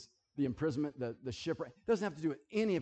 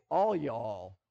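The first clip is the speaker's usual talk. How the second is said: Texan "All y'all", low and a bit louder; imitating Texans; 4 semitones lower, 4 dB louder than usual. Mocking how Texans talk, low Texan drawl. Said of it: "they say".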